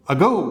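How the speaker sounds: अगो as "uh-GO", first vowel light; weak schwa opening. Shown as a demonstration. Teacher models the correct word, अगो with schwa "uh". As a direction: open अगो on a faint "uh", stress on GO. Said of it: "तो ये अ का sound हो गया".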